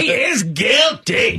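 slow, high-pitched hammed-up shout; mock shouting. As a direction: imitate a man yelling out, stretching every word. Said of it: "he just kept shouting".

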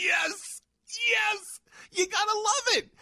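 high, cheering triumph; gleeful yes. Retold or imitated. Host cheering Trump's entrance, high and triumphant. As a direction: cheer like your team just scored. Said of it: "I go".

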